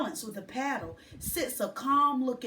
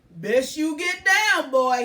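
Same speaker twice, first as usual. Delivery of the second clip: slow, louder kindly man; man suggesting; 3 semitones higher, 10 dB louder than usual. A narrator's reading. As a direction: coax the boy down. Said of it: "he suggests".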